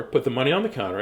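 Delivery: plain reading voice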